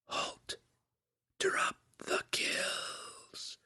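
distant unvoiced whisper, slow; a far-off voice inside the head; whispery telepathic voice. A narrator's reading. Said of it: "came another suggestion".

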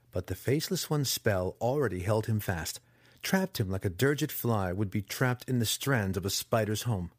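plain reading voice

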